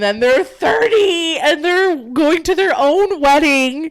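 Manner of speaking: overcome, crying through the words; tearful. Real, not acted. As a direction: draw it out high, near happy tears. Tears up telling the happy ending.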